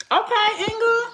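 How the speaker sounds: high, loud, delighted hello; excited greeting. Real, not acted. Greeting coworker Inga as she arrives, excited and loud. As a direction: greet her like a long-lost friend.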